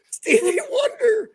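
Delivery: cracking up over the slang term; laughing outcry. Real, not acted. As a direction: wail the name through laughter.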